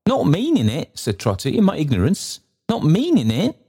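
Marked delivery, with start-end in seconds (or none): 0.1-1.0 put-on high, apologetic old man; Trotty. 1.6-3.6 put-on high, apologetic old man; Trotty.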